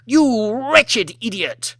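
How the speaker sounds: slow, high, venomous shriek; lady screaming at the waitress she drags off; furious insult. Acted. Lady yanking waitress's ear.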